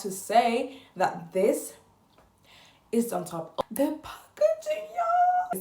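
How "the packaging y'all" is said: very high, drawn-out swooning; gushing squeal. Pretend. Vlogger gushing to viewers about the packaging, high squeal.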